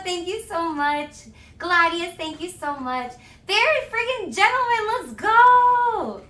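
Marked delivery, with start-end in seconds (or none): 3.5-6.2 loud, high hype shout; excited hype. Real, not acted. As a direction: holler it, stretching the last word. Hyping up donors.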